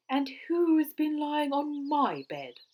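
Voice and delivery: high, loud, cross demand; cross Mother Bear. A narrator's reading. Mother Bear finding her bed used, loudly cross. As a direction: raise the voice, very cross. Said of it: "in her cross voice".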